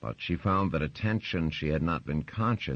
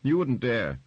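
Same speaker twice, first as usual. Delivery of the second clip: high, loud dare; defiant challenge; 9 semitones higher, 6 dB louder than usual. Acted. Wife daring husband to punish her, high and loud. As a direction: defy him to his face.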